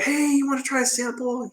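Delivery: high, soft sing-out to passers-by; friendly vendor pitch. Retold or imitated. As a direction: imitate a stallholder offering samples. Imitates vendors calling to passers-by.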